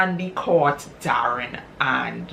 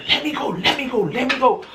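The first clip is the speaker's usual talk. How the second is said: much higher, strained voice; struggling wife; 9 semitones higher than usual. Retold or imitated. Wife held back by her husband, high and straining. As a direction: fight to break free.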